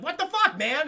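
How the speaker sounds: high, loud indignant outburst; outraged outburst. Acted. Kingpin discovers Hobgoblin's scheme, outraged outburst.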